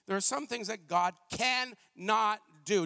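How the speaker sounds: "cannot" drawn out slow and deliberate; stress on cannot. Shown as a demonstration. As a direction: lean hard on "cannot", held. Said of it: "No, I did not stutter".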